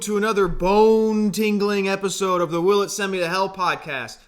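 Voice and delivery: big announcer intro voice; hammed-up show opener; podcast host intro. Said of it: "your intros get worse every time".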